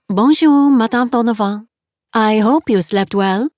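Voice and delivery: put-on 2 cheerful landlady with a heavy French accent; 3 greet her warmly in thick French-accented English; 4 French guest-house owner, heavy French accent. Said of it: "her accent was heavy".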